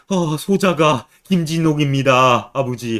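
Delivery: slow, drawn-out tearful cry to a father; mock weeping. Son revealing himself to his long-lost father, mock weeping. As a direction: act out a son's sobbing confession, hammed up. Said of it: "김진옥이 이제 우는 거야".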